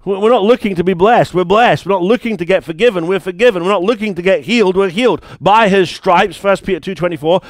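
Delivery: full-force preaching. Acted. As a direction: proclaim it to the congregation.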